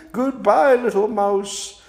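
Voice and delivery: high hooting owl voice; fretful, high-pitched owl. Acted. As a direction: speak high like a worried owl. Owl's farewell in the story.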